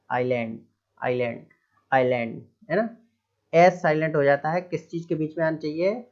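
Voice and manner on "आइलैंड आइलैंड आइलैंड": आइलैंड repeated as "eye-land", lowish drill; silent s. Shown as a demonstration. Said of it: "ऐसा पढ़ेंगे". (आइलैंड दोहराएँ, स के बिना)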